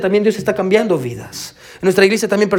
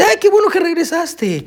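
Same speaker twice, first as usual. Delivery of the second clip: much higher, slow, delighted welcome; glad pastor; 12 semitones higher than usual. Retold or imitated. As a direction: greet him like a happy old pastor. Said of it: "lo vio y le dice". (el pastor da la bienvenida, agudo y lento)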